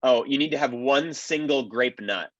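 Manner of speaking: usual speaking voice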